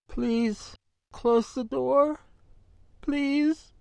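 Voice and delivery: high, slow, pleading through swollen lips; plaintive, slurred plea. A narrator's reading. A beaten young woman begs the guard to shut the door, plaintive and slurred. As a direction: beg weakly, words blurred by a hurt mouth. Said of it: "It came out plaintively and a bit slurred".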